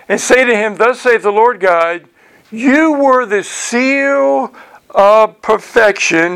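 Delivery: declaimed Bible verse; scripture reading. Acted. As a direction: read the verse aloud, stretching the final phrase slowly. Reads scripture aloud.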